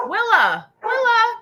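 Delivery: high, loud call across the house; calling the dog. Real, not acted. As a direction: call the dog's name out loud.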